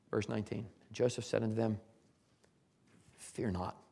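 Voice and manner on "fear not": low, soft, gentle reassurance; softly calm their fears; quiet assurance. Retold or imitated. Joseph assures his fearful brothers, low and soft. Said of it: "He assures them".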